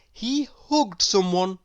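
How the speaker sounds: usual speaking voice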